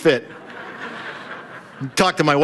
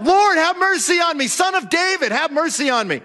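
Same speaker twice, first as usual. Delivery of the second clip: crying out; 10 semitones higher than usual. Acted. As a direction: call out desperately for mercy. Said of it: "he cries out".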